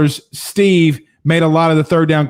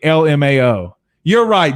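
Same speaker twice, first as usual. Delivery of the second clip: ordinary talk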